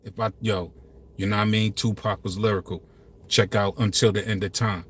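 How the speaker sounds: very low and soft, laid back; calm low voice. Shown as a demonstration. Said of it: "Because if I talk like this".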